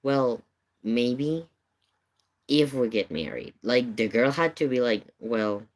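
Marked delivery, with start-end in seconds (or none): none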